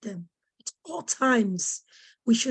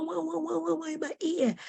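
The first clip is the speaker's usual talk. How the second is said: high, soft mocking wah wah; mock wah wah noise; 6 semitones higher, 6 dB quieter than usual. Daughter mimicking a parent's shouting as wah wah. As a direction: imitate shouting as meaningless noise. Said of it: "all I hear is wah".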